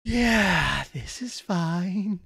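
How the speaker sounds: slow, drawn-out fake acceptance; strained false calm. Real, not acted. Reactor pretending to accept the cliffhanger, strained slow calm. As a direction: stretch it out as if coping badly.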